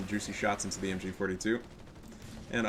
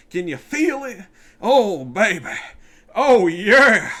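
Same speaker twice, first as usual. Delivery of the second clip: high, loud triumphant crowing; gloating hype; 9 semitones higher, 11 dB louder, much slower than usual. Acted. Streamer crowing at the beaten rival, loud and high. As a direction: crow in his face.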